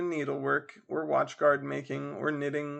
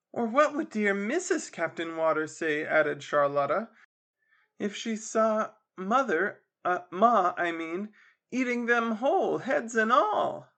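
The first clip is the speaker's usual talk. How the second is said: snooty sister; 6 semitones higher than usual. Acted. Charlotta mocks mother.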